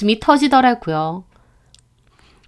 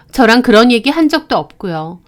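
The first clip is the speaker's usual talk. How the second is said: ordinary delivery, nothing marked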